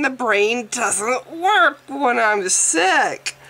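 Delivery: usual speaking voice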